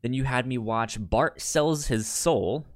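ordinary talk

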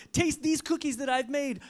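excited read-aloud character voice; excited cry. A narrator's reading. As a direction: eagerly offer a treat to a friend. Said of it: "cried Toad".

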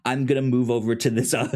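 normal talking voice

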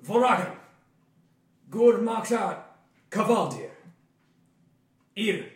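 loud, booming foreign words; booming giant voice. Acted. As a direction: boom it out like a giant. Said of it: "his booming voice".

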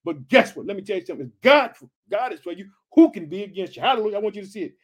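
ordinary talk, nothing marked

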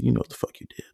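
whispered threat; menacing whisper. Retold or imitated. To the roommate, staring him down: menacing whisper. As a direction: hiss it through the dark, unvoiced. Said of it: "That's all I said to him".

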